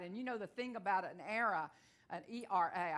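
ordinary talk, nothing marked

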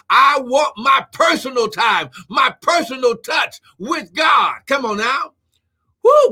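full-force preacher with whooped exclamations; impassioned preaching. Real, not acted. Preacher declaring nothing will come between him and God, impassioned preaching. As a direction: preach with fervour, punching out key phrases.

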